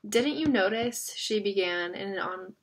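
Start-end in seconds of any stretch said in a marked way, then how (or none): none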